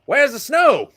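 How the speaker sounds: put-on loud, high Southern accent; hammed-up Southern drawl, shouted; mock Southern tourist shouting in disbelief. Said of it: "real thick Southern accent".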